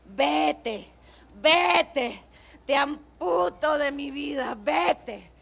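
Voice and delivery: shouting, furious Frida. Acted. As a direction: hurl it at him, loud. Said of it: "Nos gustaría hacerles un pedacito de eso".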